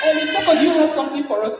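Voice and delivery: high, fast, bright; mock eager greeting. Colleagues welcoming the leader, high and fast.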